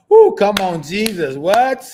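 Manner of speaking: loud, high laughing exclamations; excited outburst. Real, not acted. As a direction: burst out in disbelief.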